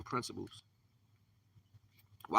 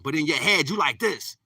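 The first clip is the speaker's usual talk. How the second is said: raised, agitated demonstration; loud head voice; 3 semitones higher, 7 dB louder than usual. Shown as a demonstration. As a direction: burst out loud like a noisy mind.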